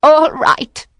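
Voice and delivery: high witch voice; yielding witch. A narrator's reading. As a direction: give in with a high cry. Frightened witch gives in.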